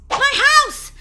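high, very loud cry of relief; relieved shout. Acted. Girl reaching home after seeing a zombie, relieved shout. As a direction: burst out at a high pitch. Relieved arrival at her house.

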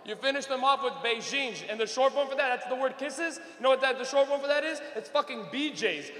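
usual speaking voice

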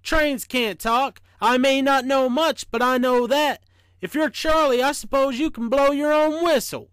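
sceptical, emphatic storybook challenge; big stern voice. A narrator's reading. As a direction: scoff loudly as if someone's playing a joke. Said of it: "in his biggest, sternest voice".

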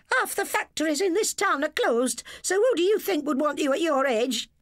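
high, loud scolding wife; angry wife. A narrator's reading. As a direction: berate the husband. Dora berates husband.

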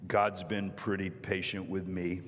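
low, slow big-man voice; big guy. Retold or imitated. Big guy answering the counter girl, low and slow. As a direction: say it deep, slow and unhurried. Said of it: "the big guy goes".

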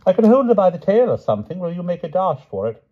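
high, quick offer from a flustered king; eager old king. A narrator's reading. The king to the knights, eager and flustered. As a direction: blurt out the plan eagerly.

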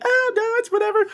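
high falsetto, dragged out and dismissive; imitating mom. Mom who thinks he's crazy, high dismissive imitation. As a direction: brush it off in a mock-mother falsetto. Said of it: "my mom thinks I'm crazy, like".

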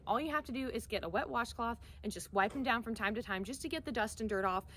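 usual speaking voice

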